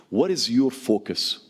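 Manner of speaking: ordinary talk, nothing marked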